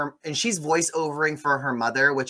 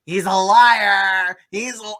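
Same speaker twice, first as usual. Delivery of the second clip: put-on drunk rant; 6 semitones higher, 7 dB louder, slower than usual. [name] ranting over wine.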